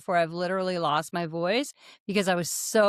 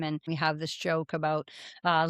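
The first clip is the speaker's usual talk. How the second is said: ordinary talk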